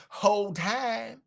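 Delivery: "whole time" drawn out slowly, Atlanta-style; Atlanta way of saying it. Shown as a demonstration. Showing viewers how Atlanta says "whole time", slowly drawn out. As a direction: stretch "whole time" slow like they say it in Fulton County. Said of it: "That's how they say it in Fulton County".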